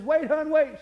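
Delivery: loud, high call to his wife; husband calling his wife back from the kitchen. Retold or imitated. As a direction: shout her back before she goes.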